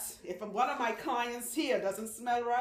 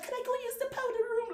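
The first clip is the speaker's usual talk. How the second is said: very high dainty lady voice; prim ladylike squeak. Retold or imitated. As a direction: ask it like a prim tiny lady. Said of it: "she like really ladylike".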